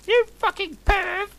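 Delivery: very high, loud falsetto jeer; hammed-up evil taunt; mock evil sneer. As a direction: sneer like a campy villainess. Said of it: "laughing with depths of evil".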